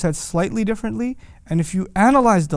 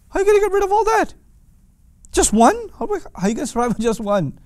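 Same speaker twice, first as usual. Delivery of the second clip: high, fast, incredulous scoff at one god; a scoffing pagan, much higher and rushed; mocking disbeliever; 12 semitones higher, faster than usual. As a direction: sneer it like a doubting idol-worshipper.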